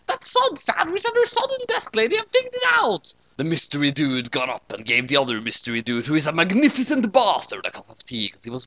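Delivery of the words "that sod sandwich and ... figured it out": very high, loud hammed-up British villain roar; roaring British accent. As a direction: bellow it like a cartoon British villain. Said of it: "he roared in a manner that was British".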